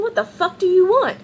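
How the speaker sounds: imitating an exasperated shout; angry yell. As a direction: snap back at the neighbor furiously. Partner confronting neighbor at door.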